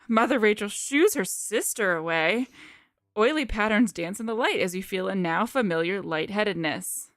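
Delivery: ordinary talk, nothing marked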